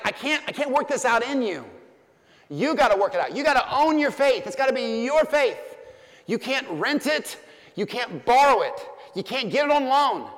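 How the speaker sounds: high, loud exhortation as Paul; preaching at full force. Acted. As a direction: drive each phrase home to the congregation. Preacher voices Paul, exhorting hard.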